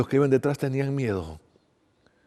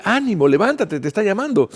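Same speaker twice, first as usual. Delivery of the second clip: raised, louder voice of encouragement; ánimo entusiasta; 7 semitones higher, 6 dB louder than usual. A narrator's reading. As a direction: urge him to get up. Crowd urging the blind man up. (voz alta que anima)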